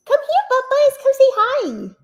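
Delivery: high sing-song coo to a pet; pet-calling voice. Real, not acted. Calling her pet over.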